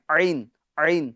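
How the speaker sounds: عین pressed from the mid throat, slow; throaty ain. Shown as a demonstration. Teacher shows the throat sound of عین, slowly. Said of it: "جو درمیان حلق سے ادا ہوتی ہے".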